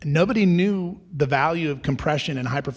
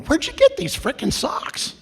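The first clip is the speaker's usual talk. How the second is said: much higher put-on voice, amazed and eager; imitating excited athletes. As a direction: squeal it like a thrilled athlete. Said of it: "all coming up to me at the end of this event going".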